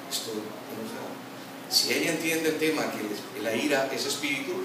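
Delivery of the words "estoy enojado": 'Estoy enojado' said much softer, almost under the breath; calm confession. Pretend. Husband quietly telling his wife he is angry, soft and calm. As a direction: confess it gently, no heat. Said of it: "Ahora le dices".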